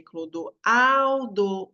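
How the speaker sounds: CLODOALDO called very slowly, AL held long and strong; drawn-out "Clodoaldo", weight on AL; stress on AL. Shown as a demonstration. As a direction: call out "Clodoaldo", leaning hard on the AL. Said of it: "o au é mais forte".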